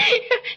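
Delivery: high, giggling laugh that trails off; real laughter. Real, not acted. [name] still laughing at Dick's mangled French. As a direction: keep laughing, barely able to stop.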